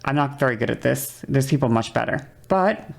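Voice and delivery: ordinary delivery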